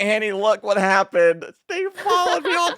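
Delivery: high, loud excited gush; excited squeal. Retold or imitated. Retelling an excited message to Annie.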